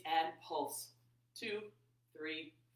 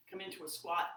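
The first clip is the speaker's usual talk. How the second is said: whispering. Real, not acted. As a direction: whisper the cue. Whispered cue in yoga class.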